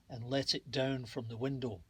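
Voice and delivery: usual speaking voice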